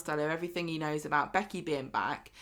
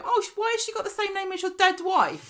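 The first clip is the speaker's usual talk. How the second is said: much higher, louder shocked blurt; mock shock at a name; mock outraged surprise; 12 semitones higher, 5 dB louder than usual. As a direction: ham up a tactless gasp of surprise. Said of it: "you wouldn't go".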